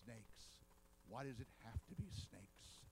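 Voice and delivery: a lightly voiced Indy dreading the snake pit; quoting Indiana Jones in a low, fed-up voice. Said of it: "And he says".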